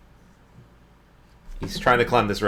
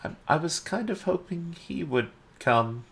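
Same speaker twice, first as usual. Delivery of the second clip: lightly voiced kid, high and soft; a hint of a timid child, pitched up; kid calling up; 8 semitones higher, 5 dB quieter than usual. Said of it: "he kinda yells up. He says".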